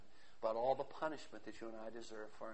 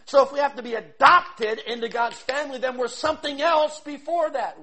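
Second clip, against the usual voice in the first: louder, higher preaching; preaching surge; 11 semitones higher, 18 dB louder than usual. Acted. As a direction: drive the point home from the pulpit.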